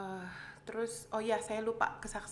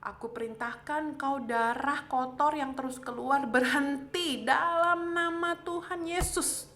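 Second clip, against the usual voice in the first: loud, raised, forceful rebuke; commanding prayer; 4 semitones higher, 7 dB louder than usual. Retold or imitated. Patient praying at her own bleeding, retold forceful command. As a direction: build to a ringing command, stretching the holy name. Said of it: "saya berdoa sama, sama darah itu".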